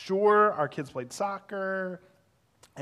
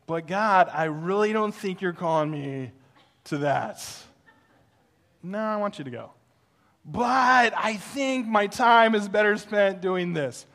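louder, higher pleading mock whine; whiny protest; 5 semitones higher, 7 dB louder than usual. Pastor whining to God to skip the party. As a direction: beg your way out of it, hammed-up.

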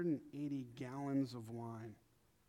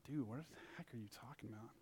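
hushed, fast, much softer bewildered aside; bewildered whisper; 3 semitones lower, 12 dB quieter than usual. Retold or imitated. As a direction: mutter it under your breath, baffled. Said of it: "I was like".

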